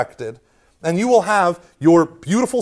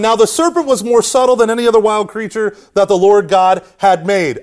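loud, higher-pitched declaimed Bible verse; scripture reading; 3 semitones higher, 5 dB louder than usual. Real, not acted. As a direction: read it out forcefully to the room. Reads the verse aloud to group.

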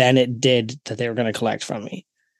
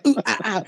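higher mock yelps; imitating hot can yelps; 8 semitones higher, faster than usual. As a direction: replay the yelps for a laugh. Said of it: "doing a monkey impression".

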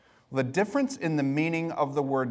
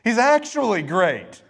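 dawning realization; 5 semitones higher, 4 dB louder than usual. Retold or imitated. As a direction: catch yourself, then exclaim the discovery. Sudden realization in worship.

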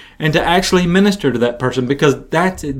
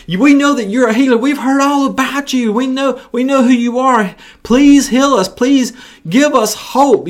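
desperate lepers pleading in a much higher voice; hollering lepers; 9 semitones higher than usual. Acted. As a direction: beg Jesus for healing, pitched way up. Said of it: "they're hollering".

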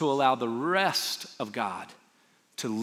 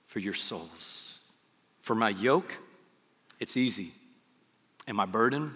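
scripture reading; 5 semitones lower, 4 dB quieter than usual. Acted. Reads scripture aloud.

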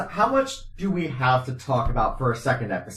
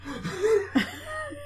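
squeaky burst of laughter; a high disbelieving laugh at the news; laughing at how long the act still is; 6 dB quieter than usual. Real, not acted. Reaction to fifteen scenes.

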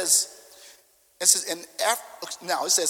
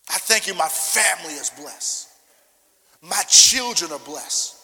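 building louder, slower chant of blessing; fervent declaration; 6 dB louder than usual. Acted. Pastor leading the church in declaration, proclaiming. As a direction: proclaim each blessing with rising force. Congregation declaration led aloud.

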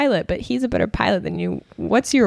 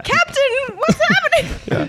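flustered lady voice; slower than usual. Acted. Players voicing Lady Blackbriar, flustered.

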